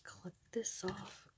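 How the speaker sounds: very soft, slow near-whisper; barely voiced, absent-minded whisper; muttering to oneself. Real, not acted. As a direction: murmur it under your breath while working. Muttering to self while working.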